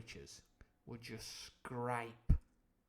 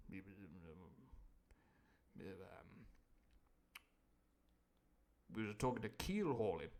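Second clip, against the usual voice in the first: timid mumble; 6 semitones lower, 9 dB quieter than usual. Acted. Morgan stalling fearfully.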